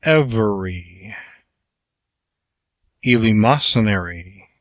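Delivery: "every" and "eleemosynary" each gliding downward, emphatic; long falling inflection. Shown as a demonstration. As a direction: let the pitch fall through "every", then "eleemosynary". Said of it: "a long falling inflection".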